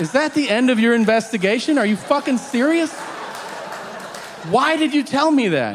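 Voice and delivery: high, loud, incredulous rant; hammed-up outraged questioning; exasperated interrogation. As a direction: demand answers from your dad, voice way up. Acted angry questioning of dad.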